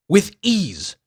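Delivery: ordinary talk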